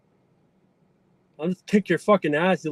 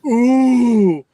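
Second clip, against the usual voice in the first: taunting hoot; 5 semitones higher, 6 dB louder than usual. Pretend.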